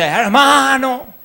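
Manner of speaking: a pastor's high, slow, drawn-out appeal; pastor calling the flock. Retold or imitated. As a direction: call the church to stay for the vigil. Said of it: "Un día el pastor dice". (pastor llamando a la vigilia, agudo y lento)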